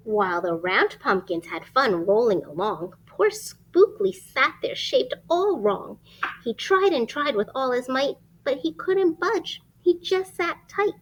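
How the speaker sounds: lively rhyming storybook read; reading to children. Acted. Reading a rhyming picture book aloud to children. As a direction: read it like bedtime story time.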